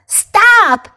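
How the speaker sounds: sharp high shout of Stop!; yelled. Acted. Pig, goat and mouse yell at the cow, shouting. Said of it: "yelled pig and goat and mouse".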